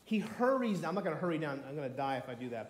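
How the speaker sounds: usual speaking voice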